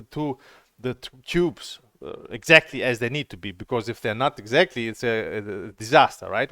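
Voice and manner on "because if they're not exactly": ordinary talk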